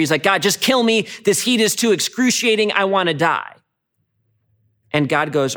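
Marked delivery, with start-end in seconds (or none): 0.2-3.6 imitating angry Jonah. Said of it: "And Jonah's so angry".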